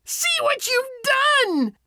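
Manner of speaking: very high, slow witch shriek; drawn-out high-pitched scream. A narrator's reading. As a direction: screech it out as a horrified witch. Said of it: "she screamed".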